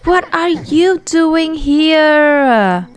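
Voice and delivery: high gasp then a slow stunned question; shocked. Acted. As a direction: voice Alex catching her friend, aghast. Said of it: "Alex is like".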